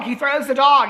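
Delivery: can you believe it, he throws the dog; incredulous exclaim. Shown as a demonstration.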